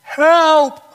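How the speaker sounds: loud, high cry for help from a pit. Acted. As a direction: shout up from the bottom of the well. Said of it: "He cried out".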